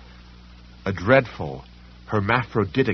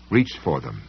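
usual speaking voice

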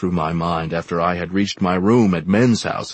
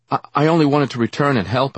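fast, higher, louder stammering plea; pleading; 8 semitones higher, 5 dB louder, faster than usual. Acted. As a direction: blurt it out anxiously.

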